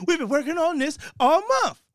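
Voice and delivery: high-pitched, half-laughing mock complaint; put-on high, aggrieved voice; exasperated bat trainer.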